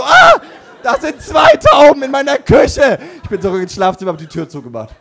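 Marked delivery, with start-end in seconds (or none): 0.0-3.0 very high, very loud, slow shriek; panicked scream. Retold or imitated. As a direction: scream in horror at two pigeons. Second pigeon discovered. (panisch kreischend, sehr hoch und laut)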